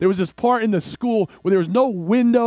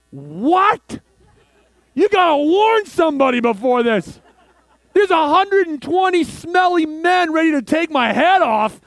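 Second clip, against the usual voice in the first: panicked disbelief; 8 semitones higher than usual. Retold or imitated. Panicked reaction to being called.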